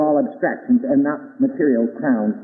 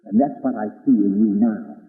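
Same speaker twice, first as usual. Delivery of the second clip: ordinary talk, nothing marked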